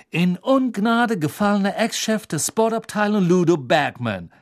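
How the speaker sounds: self-satisfied gossip, building to a headline; smug satisfaction. A narrator's reading. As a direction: relish the scandal as you spin it. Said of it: "voller Genugtuung".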